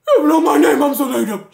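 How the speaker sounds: very loud, high mocking cry; hammed-up dramatic complaint; mock wailing. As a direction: wail it in exaggerated mock outrage. Same words repeated as loud mockery.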